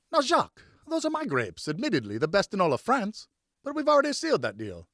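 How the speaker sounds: high, loud jovial objection; laughing protest. Acted. Richard protesting to Jacques over his grapes, jovial. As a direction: tease him back off the deal.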